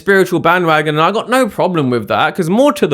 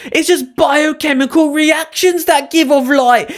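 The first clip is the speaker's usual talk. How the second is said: higher, pedantic mocking voice; mock know-it-all commenter; 8 semitones higher than usual. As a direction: sneer it like a smug online critic. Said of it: "I don't want this in the comments".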